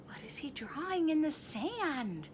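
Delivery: gasping unvoiced whisper, very soft. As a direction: hush the kids in wonder. Awed aside to kids.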